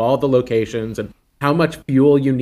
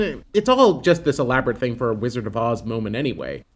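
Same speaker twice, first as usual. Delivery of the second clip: normal talking voice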